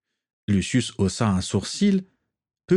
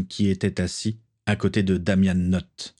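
ordinary delivery

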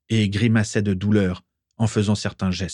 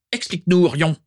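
a raised, commanding demand; 8 semitones higher, 3 dB louder than usual. A narrator's reading. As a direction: order him to explain. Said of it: "ordonna Valburga".

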